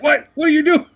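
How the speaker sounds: high, loud imitation of a startled friend; mock indignant protest. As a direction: mimic your friend's alarmed protest, voice shooting up. Mimics friend's live protest when tied.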